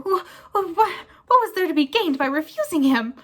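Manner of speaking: loud high sobbing excuse. Acted. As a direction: blubber it through tears.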